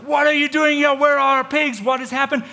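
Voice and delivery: high, very loud mock outrage; outraged villagers. Villagers arriving with pitchforks, outraged acted shouting. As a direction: yell it high like an indignant mob.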